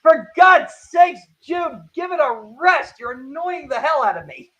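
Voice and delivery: high, loud exasperated outburst, easing off at the end; annoyed. Acted. J D telling June to give it a rest, annoyed. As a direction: act fed up, leaning hard on the first words. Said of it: "J D's a little bit annoyed".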